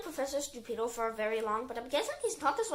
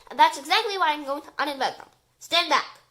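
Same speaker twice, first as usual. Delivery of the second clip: loud, emphatic declaration; commanding; 3 semitones higher, 9 dB louder than usual. A narrator's reading. Professor about to uninvent the sharks, commanding. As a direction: proclaim it, then order them back. Professor announces his destructive plan.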